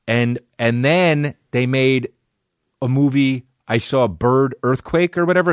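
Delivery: ordinary delivery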